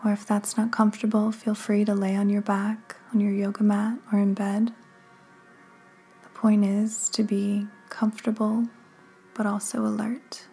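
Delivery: unhurried meditation guide, long pauses between phrases; measured, calming delivery with room to breathe; guided meditation. Acted. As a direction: guide the listener gently into stillness, phrase by phrase.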